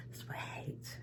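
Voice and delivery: hushed, breathy whisper at bedtime. Real, not acted. Vlogger in bed whispering to viewers, hushed whisper. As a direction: whisper so no one wakes. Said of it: "whispering in bed".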